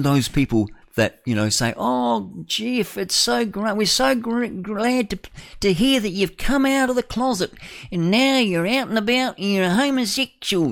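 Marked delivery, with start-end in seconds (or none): 1.8-10.7 high, sing-song gushing voice; mock gushing supporter. Mocking people who praise coming out, high gushing voice. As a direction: ham up a fawning well-wisher. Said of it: "No, they don't say that".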